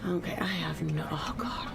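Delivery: unvoiced muttered whisper. Real, not acted. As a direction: mutter it under your breath. Muttering while playing.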